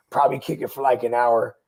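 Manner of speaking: ordinary delivery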